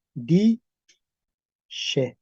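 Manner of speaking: "di she" en dos sílabas separadas, con sh suave; sonido sh. Shown as a demonstration. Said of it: "se va a transformar en". ("di she" pausado, con ese palatal)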